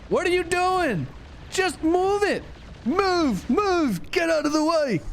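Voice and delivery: high, pleading yell; frantic yelling. Real, not acted. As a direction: urge him to move, high. Yelling at player.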